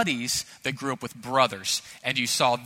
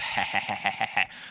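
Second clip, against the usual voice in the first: low rapid-fire ha-ha-ha; put-on machine-gun chuckle; mock staccato laugh; 6 semitones lower, 3 dB quieter than usual. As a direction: laugh in quick bursts as a tormenting brother. Brother's laugh in acted tussle.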